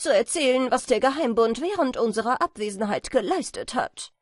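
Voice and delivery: high, loud, quick chatter; chatty swallow wife. A narrator's reading. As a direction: gossip briskly in a high, loud voice. (schwatzende Schwalbenfrau, hoch, laut und schnell)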